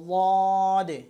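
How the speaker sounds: লদে loud and long from the tongue's side; neither z nor d. Shown as a demonstration. Teacher proving it differs from mistakes, long loud dad. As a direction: hold a heavy lateral onset into long লদে. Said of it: "জদ বলতেছি না বা দদও বলতেছি না".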